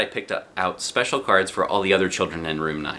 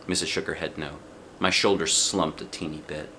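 ordinary delivery, nothing marked

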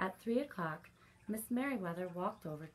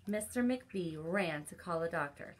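usual speaking voice

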